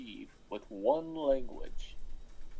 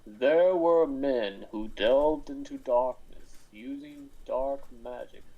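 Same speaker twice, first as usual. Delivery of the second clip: ominous proclamation; 8 dB louder than usual. Acted. Ominous tale of dark magic.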